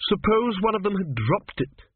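high-pitched, testy retort; snapped. A narrator's reading. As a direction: snap it out as an irritated priestess. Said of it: "she snapped".

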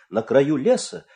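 ordinary delivery

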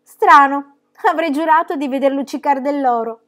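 loud, high cry of letdown; disappointed exclamation. A narrator's reading. Pippi cries out in disappointment at the fence, loud and high. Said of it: "esclamava con disappunto".

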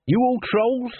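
a hurried agent at an audition; Dibbler voiced. A narrator's reading. Dibbler to the troll band, high and brisk.

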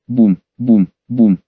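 low, heavy booms like footsteps; booming onomatopoeia. A narrator's reading. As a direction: boom it out like a giant stomping. Narrator voices giant footsteps. (низкое гулкое «бум»)

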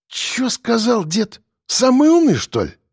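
indignant threat, rising sharply on the taunt; hissed menace. Acted. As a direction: hiss back at the old man. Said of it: "прошипел мажор".

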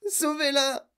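high, weaker plea; tearful wail. A narrator's reading. Mother wringing hands over daughter, tearful wail. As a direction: wail it high without pushing the volume. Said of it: "pleura madame Beauregard".

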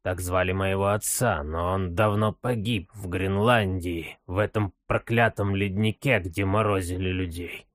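put-on low, quiet frail old man. Old father's voice.

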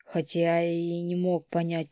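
plain reading voice

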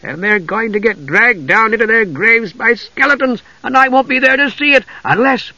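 high, bright cry; eager exclamation. A narrator's reading. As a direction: jump in with glee. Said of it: "he had an idea".

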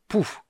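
squeaky pretend explosion; high comic puff; high mock spell puff. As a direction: puff like a magic blast. Drunk imitates a spell blast.

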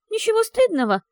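ничего стыдного wheezed very high and loud; ничего stressed in disbelief, gurgling; hoarse incredulous. Acted. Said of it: "прохрипела Софи с бульканьем в горле".

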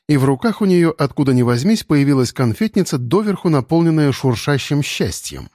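plain reading voice

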